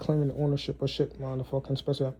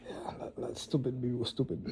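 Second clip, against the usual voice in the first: whisper; 9 dB quieter than usual. Retold or imitated. Quoting himself, whispered aside.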